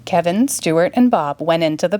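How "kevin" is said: ordinary delivery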